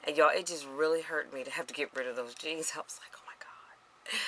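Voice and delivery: whispered confession, barely any voice. Real, not acted. Vlogger confiding to viewers about losing her jeans, whispered. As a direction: hush it like a painful secret.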